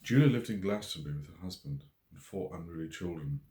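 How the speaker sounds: usual speaking voice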